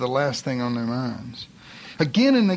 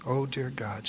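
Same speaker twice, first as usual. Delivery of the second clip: hushed prayer; 9 dB quieter than usual. A narrator's reading. Preacher voicing the mother's prayer on Easter Sunday, hushed. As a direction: drop to a hushed murmur to God. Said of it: "she prayed".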